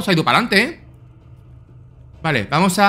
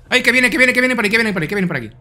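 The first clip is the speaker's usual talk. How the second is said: panicked warning; 5 semitones higher, much faster than usual. Real, not acted. Enemy approaching, panicked warning.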